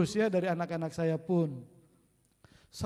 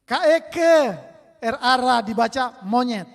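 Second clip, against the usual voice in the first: put-on child reading; 5 semitones higher, 10 dB louder than usual. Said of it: "contoh so lewat kan".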